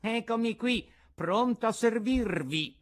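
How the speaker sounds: put-on obliging, high-pitched old carpenter; Antonio, the carpenter. A narrator's reading. Carpenter offers his friend help, high and obliging. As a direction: offer your services in a high voice.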